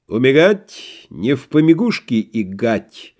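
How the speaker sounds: «Умирать не в помягушке игать» с картавым р: р звучит как г; картавое р в «помягушке» и «игать», медленно; картавя. A narrator's reading. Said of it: "вдруг картавя сказал". (картаво)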